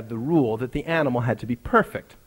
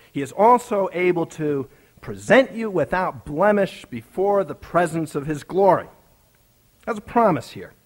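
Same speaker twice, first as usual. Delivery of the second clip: higher, louder preacher's voice, building to the climax; fervent preaching; 7 semitones higher, 3 dB louder than usual. Acted. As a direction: preach the story home with rising passion. Preaching at full force.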